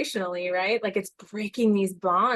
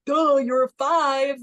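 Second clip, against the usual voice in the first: mocking duh; 5 semitones higher, 5 dB louder, slower than usual. Mocking own younger self's confusion.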